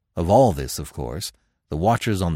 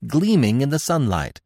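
usual speaking voice

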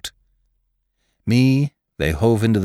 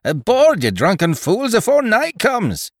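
shouting, much higher and louder; frantic yell; 14 semitones higher, 4 dB louder than usual. A narrator's reading. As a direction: bellow orders in a panic. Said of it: "he yelled frantically".

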